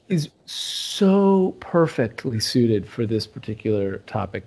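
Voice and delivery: ordinary talk, nothing marked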